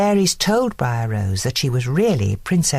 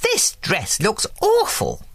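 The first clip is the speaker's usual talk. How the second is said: a hint of a fussy old fairy, slow; lightly voiced picky fairy, drawn out; slow, lightly voiced fairy complaint; 6 semitones higher than usual. A narrator's reading. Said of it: "declared Merryweather".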